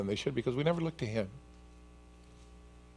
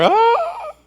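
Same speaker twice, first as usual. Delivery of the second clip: high shrieking mock scream; a hammed-up terrified shriek; frightened scream; 10 dB louder than usual. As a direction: scream as if seeing a ghost. Disciples see a ghost.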